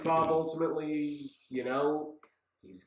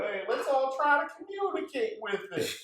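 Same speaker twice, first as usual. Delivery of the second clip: much higher goofy crowd voice; silly mock townsperson; 10 semitones higher than usual. Quoting a Bikini Bottom resident.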